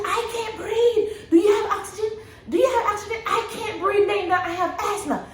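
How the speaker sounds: high, panicked pleading with a laugh; imitating herself gasping for air; retold panic. Mother on the table pleads with nurses, high and panicked. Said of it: "I started freaking out".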